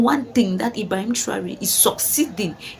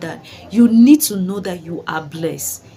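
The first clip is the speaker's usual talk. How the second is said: "need" pressed hard and the phrase said slowly and louder; emphasized slowly; 2 semitones lower, 5 dB louder than usual. Real, not acted. Urging the people of Burkina Faso, slow emphatic stress. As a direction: lean on "need" and let "you are blessed" land slowly. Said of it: "like I emphasize on that word".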